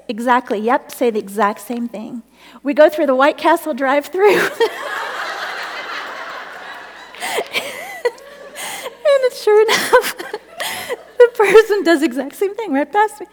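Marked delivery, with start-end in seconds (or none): 4.5-9.2 long high laugh turning almost to tears. Real, not acted. Overcome while telling the story. 9.2-13.3 higher and louder, words broken by laughter; laughing through words. Real, not acted. Laughing while telling the story.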